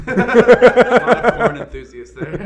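words squeezed out through laughter; slow, loud, laughing through the line; cracking up at his own joke; laughing hard. Real, not acted.

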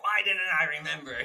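loud, high, fast mock lament; exasperated wail. Voicing a frustrated reincarnated person.

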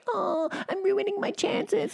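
high, slow, whiny fake lament; mock self-pity. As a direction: wail it in exaggerated woe, very high. Mocking self-pitying attitude.

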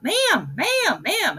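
high, swooping call for attention; retold yell. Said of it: "she yells out".

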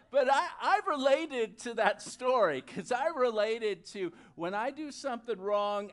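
usual speaking voice

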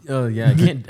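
ordinary delivery

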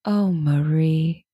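slow, low, lightly tearful; drawn-out, a hint of Sally's teary sigh; quoting Sally's tearful line to her friend; Sally Albright impression. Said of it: "My favorite line delivery that she does".

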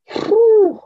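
a sharp, high blown-out whew; whew of relief. Real, not acted. Podcast guest to host about cold runs, a high whew. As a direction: blow it out like after a freezing run. Cold run, blowing out air.

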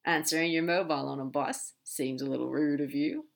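low-pitched demon teasing a friend. Acted. Crowley teasing Aziraphale about the phone, low and sly.

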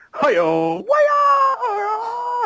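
high, slow, drawn-out wailing cry; cartoon wail. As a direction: stretch the cry way up. Imitating cartoon character's cry.

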